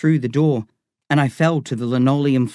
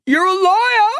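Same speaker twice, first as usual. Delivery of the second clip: shrill scream pitched far higher and louder; boy screaming after Lyle at the gate; 5 dB louder than usual. Acted. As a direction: scream the word liar at his back. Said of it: "I screamed".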